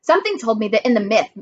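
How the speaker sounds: plain reading voice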